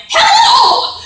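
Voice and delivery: huge, very high cartoon greeting; Wanda. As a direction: holler it in a squeaky cartoon voice. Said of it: "I come out and I'm like".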